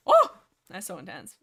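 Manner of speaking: loud gushing reaction; thrilled. Real, not acted. Reader gushing loudly after a tense passage, thrilled. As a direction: blurt it out, thrilled.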